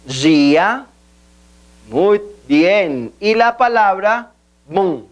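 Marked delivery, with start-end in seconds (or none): 0.1-0.9 DIA said "jee-a"; Portuguese pronunciation. Shown as a demonstration. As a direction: model DIA slowly for repetition as "JEE-ah".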